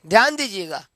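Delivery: normal talking voice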